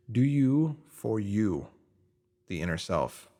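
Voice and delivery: ordinary delivery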